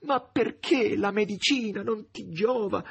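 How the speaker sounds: mild tearful anguish. Acted. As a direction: Margherita pleading in distress. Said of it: "angosciata e piangente".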